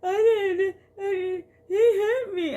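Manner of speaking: crying tattle. Retold or imitated. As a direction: blubber it like a kid running to mom. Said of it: "she'll be like".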